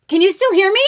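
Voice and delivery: high, loud shouting from afar; from another room. Acted. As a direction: yell up the stairs, straining to hear. Calling from the basement.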